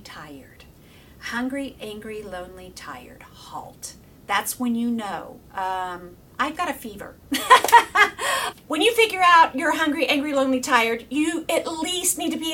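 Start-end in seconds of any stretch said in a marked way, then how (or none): 0.0-0.6 breathy whispered tired, trailing off. Shown as a demonstration. Listing HALT to viewers in a hushed whisper. 1.2-3.9 soft, hushed whisper, word by word. Shown as a demonstration. Spelling out HALT to viewers in a soft whisper. 7.2-8.6 bright burst of laughter; real laugh. Real, not acted. Laughing at her fever joke to viewers, real laugh.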